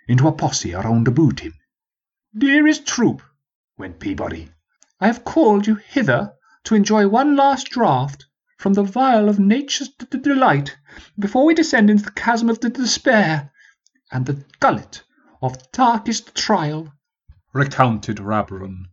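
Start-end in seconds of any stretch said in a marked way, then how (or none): 2.4-3.2 high, pompous aristocrat; lofty, grand address to troops; posh prince voice. Acted. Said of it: "switching to his posh voice for the prince". 5.0-16.9 high posh voice with stutters; pompous noble tripping on d-words; posh stuttering prince. Acted. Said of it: "posh voice ... deliberately stuttering for effect".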